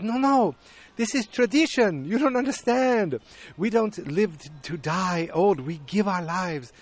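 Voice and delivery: high-pitched, insistent mock pleading; imitated elder woman. Elder woman explaining tradition to panicked guests, mock insistent. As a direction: protest as an earnest elder, easing down gradually.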